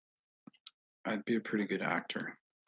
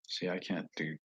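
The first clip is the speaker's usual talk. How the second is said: normal talking voice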